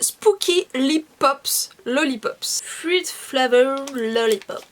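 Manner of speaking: reads "Spooky Lip Pops Lollipops" slowly in a French accent; French-accented English, read carefully and slowly. Shown as a demonstration. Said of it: "avec mon magnifique accent".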